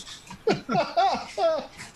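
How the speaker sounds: high-pitched cackling laugh; shrill burst of crone laughter; old woman's cackle. Acted. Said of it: "she just starts cackling, laughing".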